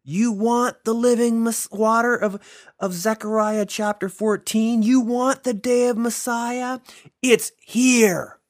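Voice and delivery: high, loud, urgent challenge; challenging shout. Acted. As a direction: fling the question at the crowd. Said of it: "Jesus stands up and screams to them".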